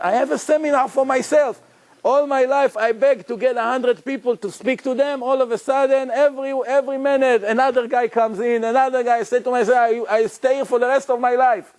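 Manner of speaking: high, bubbling excitement in the retold jail tale; excited retelling. Prisoner telling friends about his jail lectures, high and excited. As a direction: gush like a man thrilled by his luck.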